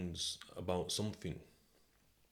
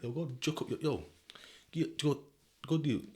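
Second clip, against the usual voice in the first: higher, louder mock hype advice; a playful mock of reckless advice; 8 semitones higher, 5 dB louder than usual. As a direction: hype them up like a bad mate. Mock bad advice quote.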